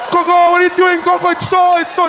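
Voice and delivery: very high, loud frightened yell; panicked child shout. Retold or imitated. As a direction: plead with grandma in panic.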